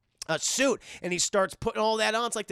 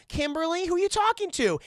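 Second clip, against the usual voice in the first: high-pitched mom calling up the stairs; a mother checking on her daughter, voice pitched high; 9 semitones higher than usual. Retold or imitated. Streamer voicing the mom calling to her daughter Kimberly, high and rising. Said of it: "he's like".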